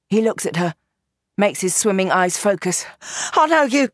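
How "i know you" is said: high, loud, strained recognition; pained gangster voice. Acted. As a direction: spit it out through the pain.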